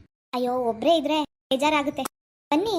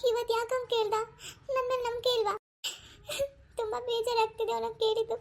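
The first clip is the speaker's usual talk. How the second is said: higher, softer words through sobs; crying; 7 semitones higher, 4 dB quieter than usual. Acted. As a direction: let the hurt break into tears. Pavi alone, crying over husband.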